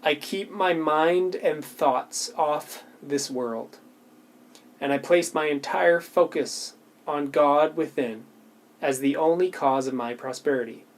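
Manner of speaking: slow, deliberate affirmation reading. Shown as a demonstration. Reading her affirmation slowly to viewers, deliberate and unhurried. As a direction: reads each word slowly, letting it land. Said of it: "I would just reread, like, one sentence".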